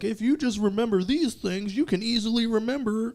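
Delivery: high-pitched mock teacher voice; high, mocking recitation of a lesson; imitated teacher. Mimics piano teachers reciting a rule.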